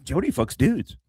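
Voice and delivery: ordinary delivery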